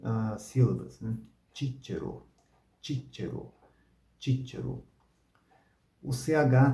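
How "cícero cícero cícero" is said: "Cícero" softer, said as "Tchítchero"; ecclesiastical Latin. Shown as a demonstration. Teacher models the name Cicero in church Latin. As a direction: say "cícero" with CH sounds, quietly. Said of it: "contém as duas, ah, sílabas".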